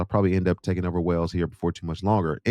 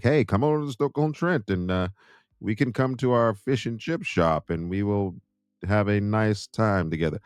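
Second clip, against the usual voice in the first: higher-pitched, slowing mock invitation; imagined friendly stranger; 3 semitones higher, slower than usual. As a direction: put on an inviting stranger, stretching the words out. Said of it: "They could be like".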